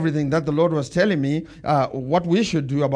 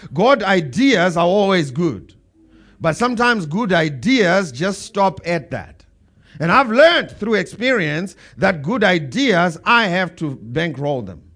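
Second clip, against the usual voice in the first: slow, loud, emphatic preaching; 6 dB louder, slower than usual. Acted. Pastor to his church, slow and loud preaching. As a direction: build it up for the congregation.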